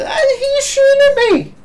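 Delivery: high-pitched yelling in panic; shrieking at the enemy player; panicked shriek. Real, not acted. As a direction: scream it as bullets fly. Gamer under fire screaming.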